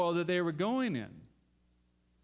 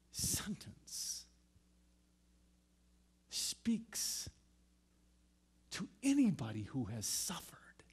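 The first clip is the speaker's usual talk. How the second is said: whisper; 6 dB quieter, slower than usual. Real, not acted. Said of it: "hushed sermon point".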